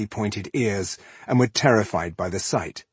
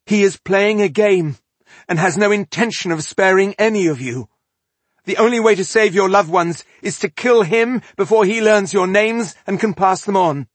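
high, loud, pressing warning; rally them to strike now; urgent exhortation; 11 semitones higher, 7 dB louder than usual. A narrator's reading. Rebel urging conspirators to attack the elf, urgent. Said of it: "she urged the conspirators".

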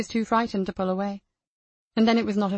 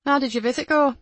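female character voice; 5 semitones higher, 4 dB louder than usual. Acted. Nicky asks colleagues.

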